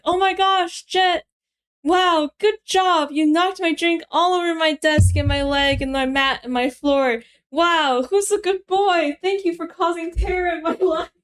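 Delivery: high, mock-cheerful praise to a pet; sarcastic praise. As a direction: gush at the dog with heavy sarcasm. Sarcastic praise to her dog Jet.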